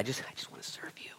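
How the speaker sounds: fast, breathless whisper to God; hushed devotion; whispered prayer. Pretend. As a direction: murmur it like a private vow.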